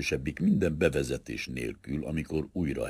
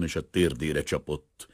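usual speaking voice

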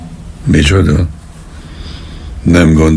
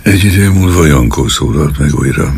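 ordinary delivery

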